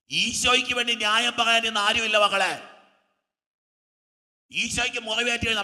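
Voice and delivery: ringing sermon appeal; impassioned preaching. Real, not acted. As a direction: cry it out to the congregation. Preacher laments to congregation loudly.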